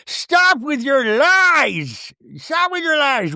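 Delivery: high, drawn-out diva protest; slow, hammed-up high-pitched accusation in a put-on voice; Meredith. Castmate accusing Whitney of lies, mock-outraged.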